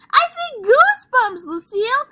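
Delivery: very high and loud; excited shout. Acted. Sheldon shouts to Lucille about his goosebumps, high and loud. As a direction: call out eagerly to Lucille.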